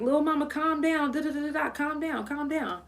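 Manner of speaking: hoch und leise, als würde man jemanden beruhigen; imitating the homeboy. Homeboy calming her down.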